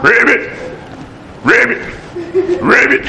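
croaked ribbits; frog voice. Retold or imitated.

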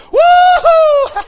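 high laugh into a loud woo-hoo; joyful laughing whoop. Real, not acted. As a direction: burst out laughing with joy. Preacher bursts out in joy.

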